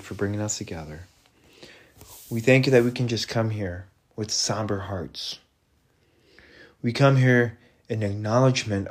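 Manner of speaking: earnest prayer voice, lightly held; closing prayer. Acted. Preacher leading the congregation in a closing prayer, earnest. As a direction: pray it gently to the Father.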